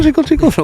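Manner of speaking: very high, tinkling sing-song; mocking little jingle up high; imitated bell tinkle. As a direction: mimic a tiny shop bell.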